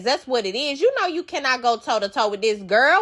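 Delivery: ordinary delivery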